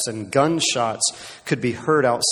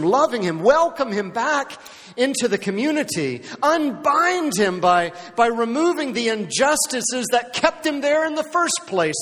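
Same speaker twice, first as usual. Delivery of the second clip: high, ringing preacher's call, slowing on key phrases; impassioned preaching; 10 semitones higher than usual. Acted. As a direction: preach it to the whole congregation. Jesus' charge, preached with rising force.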